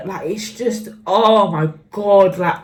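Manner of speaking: ordinary talk, nothing marked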